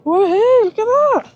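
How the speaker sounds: high loud cheering; celebratory whoop. Real, not acted. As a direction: whoop and point at the find. Cheering friend's find.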